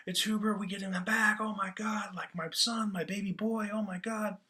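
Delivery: high-pitched, overjoyed mock outburst; hammed-up thrilled disbelief; thrilled mother. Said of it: "She's thrilled".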